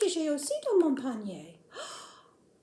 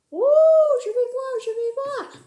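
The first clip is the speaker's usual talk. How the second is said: excited puppet; 10 semitones higher, 11 dB louder than usual. Acted.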